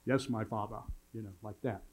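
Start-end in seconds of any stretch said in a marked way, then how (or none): 0.0-0.8 low, mock German accent; lightly put-on German accent, softer. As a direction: imitate kids' German-accented drill. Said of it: "either German accent or something to do with Star Wars".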